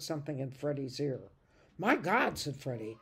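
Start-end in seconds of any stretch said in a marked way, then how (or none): 1.8-2.4 shocked. Acted. Boy shocked by news.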